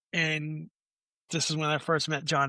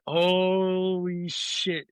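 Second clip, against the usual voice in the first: very slow, drawn-out "holy"; acted-out sinking realization; exasperated disbelief; 3 semitones higher, much slower than usual. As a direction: stretch it out in dismay. Old embarrassment follows him again.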